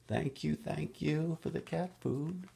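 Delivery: high, soft kitten voice; kitty voice. Acted. Reading to kids, kitten thanking for food in high voice.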